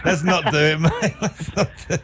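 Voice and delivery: giggling through the words; laughing high and breathy. Real, not acted.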